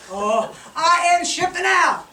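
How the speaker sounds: loud, high hammed-up commercial voice; ad announcer. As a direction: sell it like a cheesy ad. Mock ad announcer pitch.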